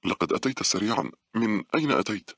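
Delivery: worried. A narrator's reading. Monica questions the newly arrived young man, mildly worried. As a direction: question him with a touch of unease. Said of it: "سألته مونيكا بقلق".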